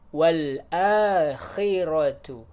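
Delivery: akhiratu with a harsh throat kh; rough kha. Shown as a demonstration. Teacher models akhiratu with a harsh kha. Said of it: "usahakan dia kasar keluar dari tenggorokan".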